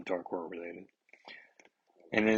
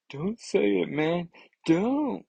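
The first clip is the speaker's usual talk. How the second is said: pleading hero; 9 semitones higher, 3 dB louder, slower than usual. Acted. Reader voicing panicked comic hero.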